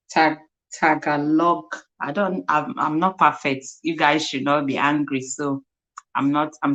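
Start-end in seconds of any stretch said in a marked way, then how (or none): none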